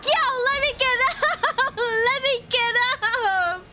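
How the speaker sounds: high yelling broken by laughter; laughing plea. Real, not acted. Knocked down, pleading through laughter.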